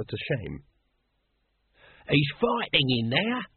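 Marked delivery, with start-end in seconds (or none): 2.1-3.5 drawn-out, higher-pitched agitated question; slow, high worried outburst; stuttered alarm. A narrator's reading. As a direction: stretch it out in rising alarm. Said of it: "he stuttered".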